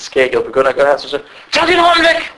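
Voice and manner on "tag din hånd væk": high-pitched imitated outburst; sharp rebuke. Sheikh to a brother touching his beard, high mock outrage. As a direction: mimic an indignant order, pitch jumping way up.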